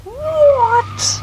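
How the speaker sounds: high, loud disbelieving cry; incredulous outcry. Real, not acted. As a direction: shoot the pitch up in disbelief. Reacting to shooting in game.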